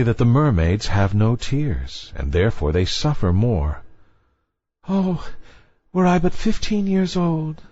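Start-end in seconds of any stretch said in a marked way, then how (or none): 4.9-7.6 put-on high, longing young girl; a wistful little mermaid. A narrator's reading. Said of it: "said she".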